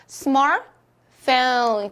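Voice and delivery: SMARTPHONE said slowly as English "smar-fone", middle T dropped; English pronunciation demo. Shown as a demonstration. Teacher models the word for the class, English pronunciation. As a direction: draw out 스마트폰 in two beats, T left out. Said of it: "어떻게 읽을 수 있죠".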